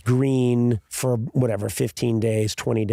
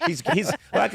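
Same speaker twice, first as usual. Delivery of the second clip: ordinary talk, nothing marked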